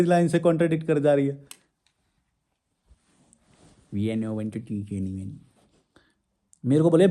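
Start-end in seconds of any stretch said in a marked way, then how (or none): 3.9-5.3 much lower and softer, slow mocking echo; mocking repeat. Vlogger mocks a viewer's comment, low and scornful. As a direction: mutter it back like a sneer.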